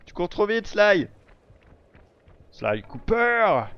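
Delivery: lightly voiced gruff game boss; slow, drawn-out villain voice; Panga King impression. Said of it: "J'essaie d'imiter la voix du Panga King".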